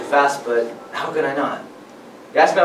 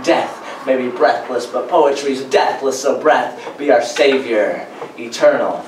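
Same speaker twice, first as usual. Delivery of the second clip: pitched-up, driving slam poetry delivery; spoken-word performance; 5 semitones higher, 4 dB louder than usual. Acted. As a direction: perform it to a crowd at the mic.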